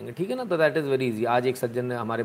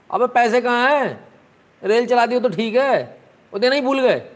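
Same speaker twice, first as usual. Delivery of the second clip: mock indignant; 9 semitones higher, 6 dB louder than usual. Host grumbles about missing contributions.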